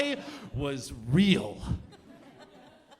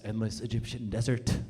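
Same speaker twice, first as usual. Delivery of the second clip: ordinary talk, nothing marked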